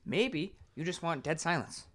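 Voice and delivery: ordinary talk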